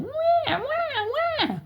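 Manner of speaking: very high imitated baby-like wail; mock crying wail. Losing kid crying his eyes out, mock wailing. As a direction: fake a bawling cry in falsetto. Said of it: "he's crying".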